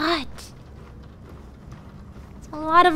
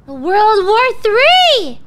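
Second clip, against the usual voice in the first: slow, loud alarmed cry; excited shout; 9 dB louder, much slower than usual. Real, not acted. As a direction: blurt it out in shock. Startled by news in game.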